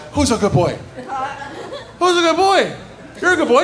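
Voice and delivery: high sing-song praise for a dog; dog baby talk. Acted. Pet owner cooing to a dog, high baby talk.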